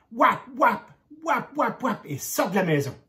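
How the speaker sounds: stamp out the pigs' hooves; galloping sound. A narrator's reading. Cochons qui s'enfuient. (onomatopée aiguë des sabots qui filent)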